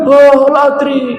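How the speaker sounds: high, helpless clueless whine; the lost soul who knows nothing; dazed unbeliever. Acted. Unbeliever answering the angels. (orang kafir yang bingung, suara tinggi memelas)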